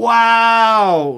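high, loud drawn wow; mock awe. Real, not acted. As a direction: gasp it out in awe. Reacting to morbid remark.

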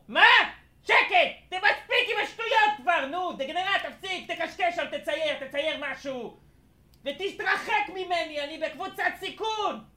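cranky grandpa scolding in a high put-on voice; angry old man. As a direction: snap at the kid like a fed-up grandpa. Grandpa yelling at someone off-mic. (סבא כועס בקול מוגבה)

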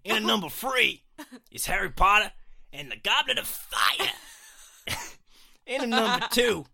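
loud, high hammed-up New York accent. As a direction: belt it out as a cartoonish New York boy. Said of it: "shall I do a New York accent".

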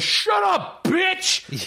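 high, shrieking yell of Shut up, bitch; X-Pac yelling. Retold or imitated. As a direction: shriek it at Missy like a sleazy wrestler. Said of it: "And X-Pac goes".

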